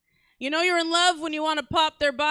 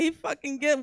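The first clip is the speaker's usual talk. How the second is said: ordinary talk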